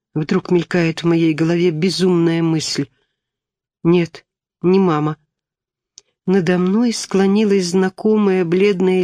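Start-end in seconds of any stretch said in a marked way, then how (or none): none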